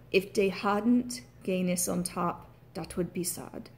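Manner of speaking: slow, careful Irish demo, AH vowels; Irish accent. Shown as a demonstration. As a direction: lean on Irish AH in the sentence. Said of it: "write a sentence to prove my point".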